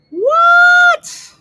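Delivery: a squeaky, loud, stunned what; shocked high what. Real, not acted. Reacting to a viewer's cheap rent, a loud squeal of disbelief.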